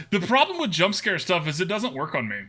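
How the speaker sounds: ordinary talk, nothing marked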